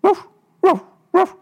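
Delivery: very high, loud barks; the cook and baby yell the refrain; barked chorus. A narrator's reading. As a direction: yap it out like a shrieking chorus. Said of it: "Der Chor".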